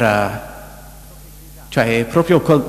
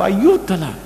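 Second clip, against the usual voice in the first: mock pleading cry; 8 semitones higher, 3 dB quieter than usual. Preacher cries to God for the woman, mild high plea. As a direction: plead for her without full force. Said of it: "Io gridavo".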